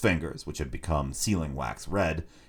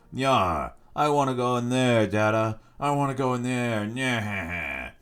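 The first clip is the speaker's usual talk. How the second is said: put-on higher, whining little boy; squeaky whining child; 6 semitones higher, slower than usual. Spoilt boy at the shop door whines to his father. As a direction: wail like a spoilt child begging to get in. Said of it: "a living, squeaking voice".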